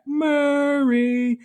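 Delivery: very high, loud, drawn-out chant; crowd yelling back. Retold or imitated. Crowd answers the announcer after a three, yelling long and high. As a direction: answer the announcer like a whole arena. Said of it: "the crowd yells back".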